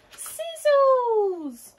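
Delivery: very high, loud, long call; excited shout. A narrator's reading. Lola spots the missing dog, shouting high. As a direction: cry the dog's name out at the top of the voice.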